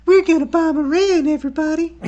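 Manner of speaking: very high, breathy falsetto president. Pretend. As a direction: squeak it out in a tiny high voice. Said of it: "Could you imagine that?".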